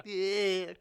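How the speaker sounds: put-on high, loud slurred drunk girl voice; mock a wasted party girl. Mocking the drunk promo girl, loud and high.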